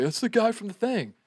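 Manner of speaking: high-pitched mock freak-out; put-on high voice of giddy recognition; imitated amazed excitement. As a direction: mimic someone losing their mind at recognizing him. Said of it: "lose their minds and be like".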